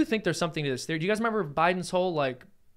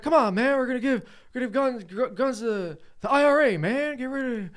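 higher, hammed-up president voice; mock Biden; 6 semitones higher than usual. Host imitating Biden arming the IRA in a State of the Union. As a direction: play a folksy rambling old politician. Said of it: "He's like".

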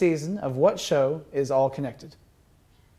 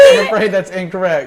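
mock game-show ruling; 3 semitones higher, 10 dB louder than usual. Host repeats ruling, laughing.

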